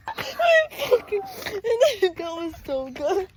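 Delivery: high, slow, breaking into laughter; laughing. Real, not acted. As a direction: crack up mid-sentence. Cracking up at friend.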